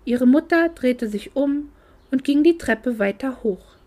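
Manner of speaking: plain reading voice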